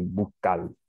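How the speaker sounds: "Buscar" said Dominican-style as "bukal"; mimicked Dominican accent. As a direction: drop the s in "Buscar" and end it on an l. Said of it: "I'm mimicking what he's saying".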